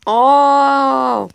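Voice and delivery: startled yell; loud shocked oh. Real, not acted. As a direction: yelp it out in alarm. Sudden loud exclamation.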